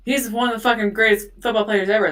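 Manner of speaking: loud, fast fan outrage; retold adamant defense. Imitating grandparents standing up for OJ, adamant and loud. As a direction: defend your idol loudly and quickly.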